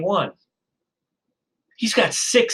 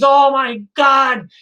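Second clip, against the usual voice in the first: high, loud horrified yell; shocked factory owner shrieking, much higher and louder; panicked scream; 8 semitones higher, 3 dB louder than usual. Acted. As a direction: scream it in horror from the office above. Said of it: "and he screams".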